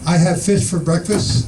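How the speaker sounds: ordinary talk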